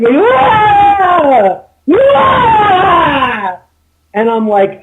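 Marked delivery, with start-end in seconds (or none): 0.2-3.6 imitated high, loud scream; two long shrieks, mimicking her screaming; shrill scream. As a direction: shriek out a drawn-out "Ah!" as she did. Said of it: "she's shrilling".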